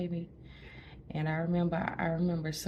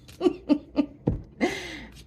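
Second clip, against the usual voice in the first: high laugh; 14 semitones higher, 6 dB louder than usual. Real, not acted. Laughing at own joke.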